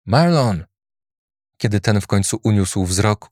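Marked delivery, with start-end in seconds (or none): 0.0-0.7 hushed but urgent call; stage whisper. A narrator's reading. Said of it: "Scenicznym szeptem i z powagą zawołał Andy".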